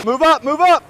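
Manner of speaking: loud, high shout to the herd; foreman shouting over noise. Real, not acted. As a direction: yell it across the pen. Driving cattle, calling out.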